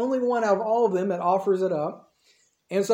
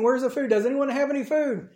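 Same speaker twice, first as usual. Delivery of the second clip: raised pitch, asking round a crowd; calling around; 5 semitones higher than usual. Retold or imitated. Disciples asking the crowd for food, calling around. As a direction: call it out higher, searching for an answer.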